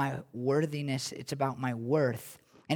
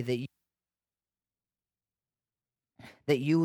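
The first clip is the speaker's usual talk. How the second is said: earnest, hushed prayer voice with long pauses; pastoral prayer. Acted. Leads a closing prayer.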